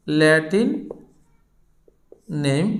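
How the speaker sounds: Latin, a long pause, then নেম; slow dictation. Real, not acted. Teacher labelling the names for students, slow dictation. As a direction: dictate Latin and নেম one word at a time.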